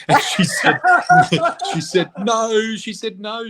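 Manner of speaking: high-pitched, laughing through the words; real laughing retelling. Real, not acted. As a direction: break up laughing as you quote the answer. Laughing while retelling the daughter's question.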